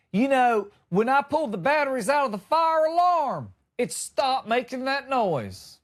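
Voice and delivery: lightly voiced dad, slowing at the end; mock dad voice. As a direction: play the smug dad with the fix. Dad's solution voiced.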